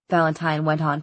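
plain reading voice

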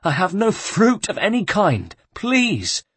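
very high, desperate please; begging with the last breath; pleading monk. Acted. The monk begs the guard, very high.

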